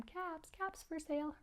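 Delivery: soft, high-pitched vendor's cry; quiet preview call. Shown as a demonstration. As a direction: preview the street call under your breath.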